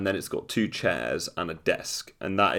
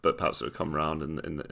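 ordinary delivery

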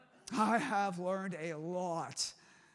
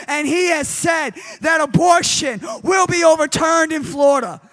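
shouting high and loud, building to a roar; full-force preaching; 7 semitones higher, 22 dB louder than usual. Real, not acted. Pastor rallying his church against abortion, roaring. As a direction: preach it like a battle cry to the congregation.

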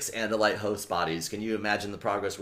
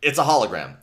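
loud, higher-pitched call-out; yelling to everyone; 4 semitones higher, 7 dB louder than usual. Retold or imitated. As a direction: call it out to the whole group. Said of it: "so Jake yells to everyone".

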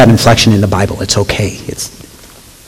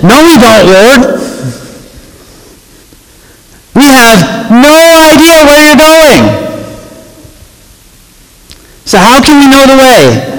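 high, baffled disciple protesting; Thomas, baffled; 12 semitones higher than usual. Acted. Thomas objecting to Jesus, high baffled voice. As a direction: play Thomas high and lost. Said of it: "Thomas said".

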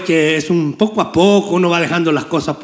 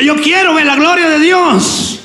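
much higher, full fervent cry; 10 semitones higher than usual. Shown as a demonstration. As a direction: proclaim it like it finally arrived. (con fervor, mucho más alto)

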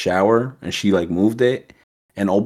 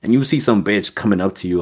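ordinary delivery

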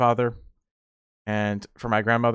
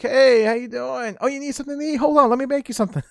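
eager host at midnight; grandma woken up, high and eager to feed you; doting grandma; 11 semitones higher than usual. Retold or imitated.

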